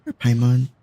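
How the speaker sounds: ordinary talk, nothing marked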